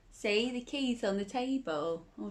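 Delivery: plain reading voice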